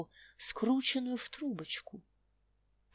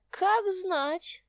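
put-on old tramp voice; 8 semitones higher, 5 dB louder than usual. Old tramp answers slyly.

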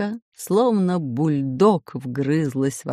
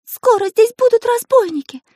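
frightened Karandash; 7 dB louder than usual. Acted. Karandash warns of robbers.

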